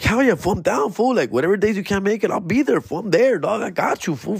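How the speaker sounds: high, eager yes. Retold or imitated. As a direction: jump at the chance, voice up high. Eager to fill in.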